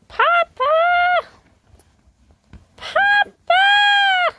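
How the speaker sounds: high, loud shouted calls for Papa; calling out. Acted. Little figure calls for his papa.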